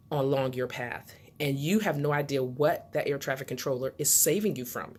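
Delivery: normal talking voice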